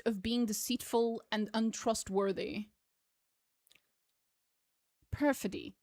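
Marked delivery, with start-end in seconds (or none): 5.1-5.7 "Perfidy" as PER-fi-dee, stress on the first; careful "Perfidy", stress on PER; new word said out. Shown as a demonstration. Reading a freshly looked-up word, careful and stressed on "Perfidy". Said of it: "apparently is how you pronounce that".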